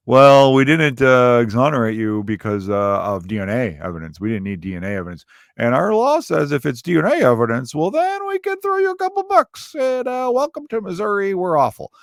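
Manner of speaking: lampoon a smug state official; mocking state official. State of Missouri voiced.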